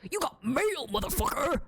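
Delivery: mock tough-guy notification shout; failed gruff voice. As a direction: bark it tough, but it cracks upward. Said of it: "like, gruff?".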